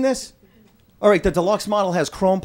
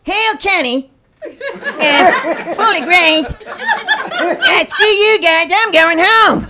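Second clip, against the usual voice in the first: much higher, louder cartoon kid voices; South Park kids; 9 semitones higher, 6 dB louder, much slower than usual. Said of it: "They're like".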